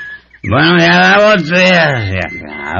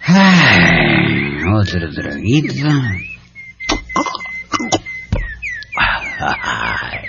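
put-on drunken dreamy reverie; 6 semitones lower, 4 dB quieter than usual. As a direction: sigh it out, lost in memories.

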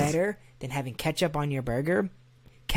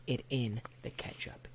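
whispered punchline; 4 semitones lower, 13 dB quieter than usual. Acted. Punchline dropped low.

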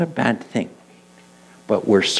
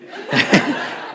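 an airy, voiceless laugh; laughing at the memory of the backwards dress; breathy laugh; 5 semitones higher than usual. Real, not acted. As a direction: let out a breathy chuckle. Laughs after daughter's quote.

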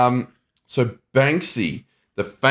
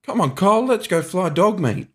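character quote; 8 semitones higher than usual. Retold or imitated. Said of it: "he's like".

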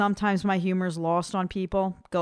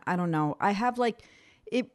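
normal talking voice

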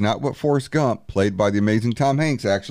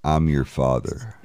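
deep low Vader line; low mock Darth Vader; 10 semitones lower than usual. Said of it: "All he really said was".

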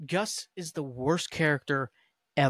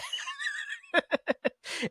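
real laugh; 5 dB quieter than usual. Real, not acted. Laughs after ranting about show.

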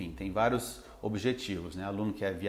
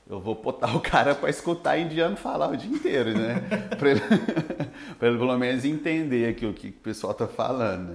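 laughing; 3 semitones higher, 4 dB louder than usual. Real, not acted. Laughing at his own joke.

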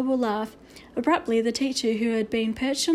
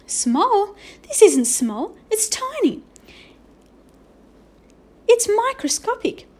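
astonished teacher, climbing to a high squeal; voice rising higher; 10 semitones higher, 7 dB louder, slower than usual. A narrator's reading. Teacher amazed at Omri's tiny drawing, voice rising higher. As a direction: climb higher with mounting disbelief. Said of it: "Her voice was rising higher with every word".

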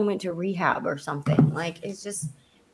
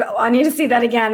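excited; 4 semitones higher, 6 dB louder, much faster than usual. Retold or imitated. Retelling her excited reaction.

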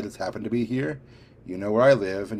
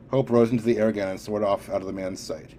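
usual speaking voice

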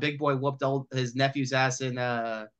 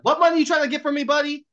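high, loud, fast impersonated threat; mock shakedown; 12 semitones higher, 6 dB louder than usual. Man dangling Jackie off balcony, mock threat. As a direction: rattle it off loud and high like a cartoon tough guy.

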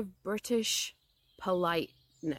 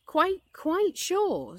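high, very slow insistence; emphatic assurance; 11 semitones higher, 4 dB louder, much slower than usual. A narrator's reading. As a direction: draw out each word to convince. Pooh insists he is himself.

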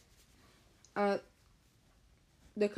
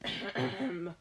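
deliberate throat-clear; 3 semitones lower than usual. Acted. Boy preparing to cast a spell, throat clearing. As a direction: clear your throat before performing. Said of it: "He cleared his throat".

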